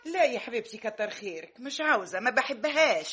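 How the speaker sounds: an offended, prickly "no thanks"; huffy refusal. Acted. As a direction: turn the child down in a huff. Offended aunt refuses chocolate.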